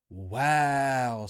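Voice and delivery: a loud, stunned wow. Retold or imitated. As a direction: sound floored by the lie. Stunned at boss lying.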